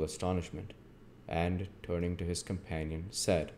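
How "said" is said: ordinary delivery, nothing marked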